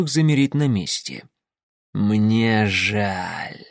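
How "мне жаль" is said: low, sexless melodic voice; eerie melodic voice. Acted. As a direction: lull them with a strange melodic voice. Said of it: "странный, не мужской и не женский".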